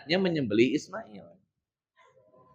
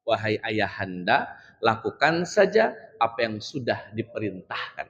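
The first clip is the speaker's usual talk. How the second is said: softer and slow, a dutiful son; lightly voiced obedient son, hushed; Ismail's gentle reply; 5 semitones lower, 6 dB quieter, slower than usual. Ismail answering his father Ibrahim, softly and slowly. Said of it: "Jawaban Ismail nih".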